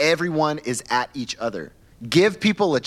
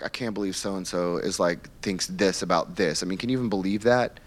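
lightly voiced gossip, lower and softer; a hint of a catty church gossip, lower; gossiping churchgoer; 8 semitones lower, 4 dB quieter than usual. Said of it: "somebody gives some comment like".

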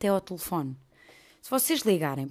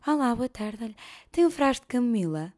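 higher, cheerful asking voice; friendly customer; 5 semitones higher, 4 dB louder than usual. Retold or imitated. Customer politely asking shop lady for camomile. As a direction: ask brightly and politely. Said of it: "perguntei qualquer coisa".

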